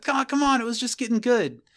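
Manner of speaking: high-pitched, exasperated complaint; mock protest. Eleanor after Chidi stops the kiss, exasperated protest. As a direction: protest up high, as if cut off too soon. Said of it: "Eleanor with, like, her tongue out, be like".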